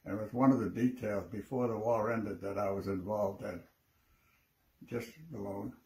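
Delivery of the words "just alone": ordinary delivery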